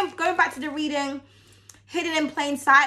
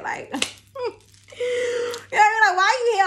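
laughing; 9 semitones higher than usual. Real, not acted. Cracking up mid-rant.

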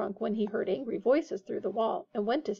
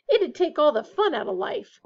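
higher, louder protest; revolted; 6 semitones higher, 5 dB louder than usual. A narrator's reading. George rejects being a prefect, revolted. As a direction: object as if the idea is dreadful. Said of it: "looking revolted at the very idea".

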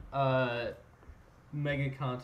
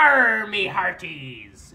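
slow, much louder hearty call; pirate shout; 3 semitones higher, 13 dB louder, much slower than usual. Pretend. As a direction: bellow it like a ship captain. Pirate greeting.

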